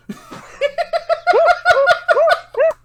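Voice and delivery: shrieking high burst of laughter; big laugh. Real, not acted. Friend cracking up at Kronk, a high real laugh. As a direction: laugh out loud, high and helpless.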